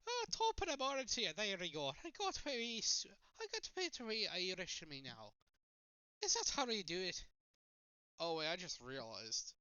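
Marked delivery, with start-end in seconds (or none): 0.1-7.2 loud, high hammed-up Irish accent; mock Irish accent. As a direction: play a cartoon Irishman. Stereotype Irish voice.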